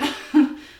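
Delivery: loud burst of breathy laughter; brief amused burst; short laugh. Real, not acted. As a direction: let out a quick, sudden laugh. Laughs while quoting her own reply.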